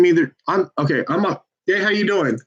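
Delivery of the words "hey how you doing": bright, higher cold-call hello; upbeat telemarketer hello; phone greeting. Real, not acted. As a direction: greet the stranger cheerfully.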